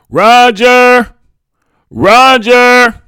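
high, loud mother's call across a courtyard. A narrator's reading. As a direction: holler for your son, drawn out and high. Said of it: "my mother's voice calling".

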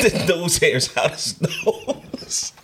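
high, slow, laughing. Real, not acted. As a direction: squeeze it out through laughter. Laughing mid-sentence.

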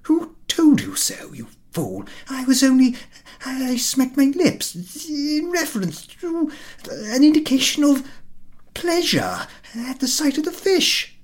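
high, loud, stammering excuse; flustered host. A narrator's reading. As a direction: scramble to explain yourself. Ahiniv flustered, defending himself.

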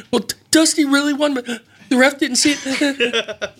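put-on high, slow, half-laughing sobbing kid; crying kid explaining. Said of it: "through, like, breaths".

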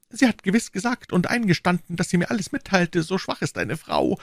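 high, anguished lament; despairing prisoner. Acted. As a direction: bemoan your fate in a high voice. Voices Bonacieux lamenting to himself.

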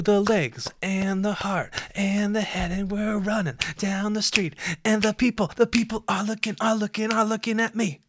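high, put-on excited voice; hyped-up running riff. Runner narrating his jog past staring people, goofy and high.

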